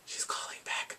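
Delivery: hushed, breath-only reveal; suspenseful whisper. Retold or imitated. Telling the moment she phones back, suspenseful whisper.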